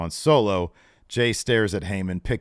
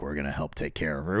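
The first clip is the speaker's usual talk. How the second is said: mock sinister; 5 semitones lower, 5 dB quieter, faster than usual. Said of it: "very sort of a sinister note".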